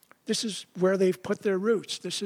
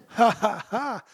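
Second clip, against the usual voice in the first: real laugh; 4 semitones higher, 3 dB louder, slower than usual. Real, not acted. Laughing at the people's folly.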